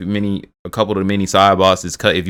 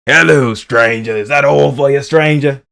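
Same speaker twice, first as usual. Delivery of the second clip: slow, loud, higher put-on merchant voice; merchant impression; 7 semitones higher, 7 dB louder, slower than usual. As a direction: audition for the merchant role. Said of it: "They coulda casted me".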